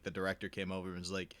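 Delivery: ordinary delivery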